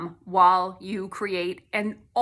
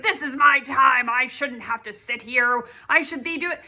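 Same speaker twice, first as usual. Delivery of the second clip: mock indignant, louder; huffing about wasted time; riled up; 4 semitones higher, 6 dB louder than usual. Complaining at the eye doctor, mildly riled up. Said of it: "getting all riled up".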